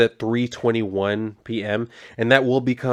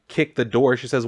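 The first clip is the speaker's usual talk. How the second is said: ordinary delivery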